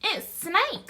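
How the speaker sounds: high, loud little mouse voice; mouse character. Acted. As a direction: pipe up as a confident mouse. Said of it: "said the mouse".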